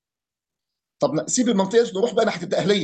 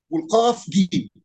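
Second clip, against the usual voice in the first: ordinary delivery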